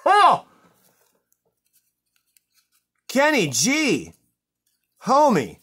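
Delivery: high, loud disbelief; shocked exclaiming. Real, not acted. Host to [name] who sent it, aghast cry. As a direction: call the name out, aghast.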